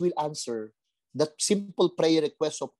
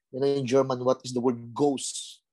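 ordinary delivery

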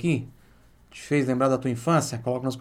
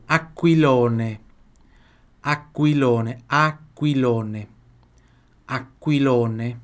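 slow model; 3 dB louder, much slower than usual. Shown as a demonstration. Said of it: "slow model repetitions".

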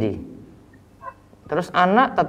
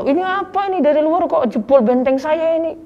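indignant outburst; 12 semitones higher than usual. Retold or imitated. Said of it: "dia nggak terima".

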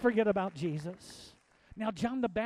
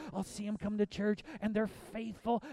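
ordinary talk

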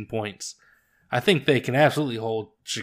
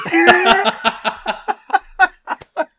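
loud high laugh; 6 dB louder than usual. Real, not acted. Laughing at own Lions pick.

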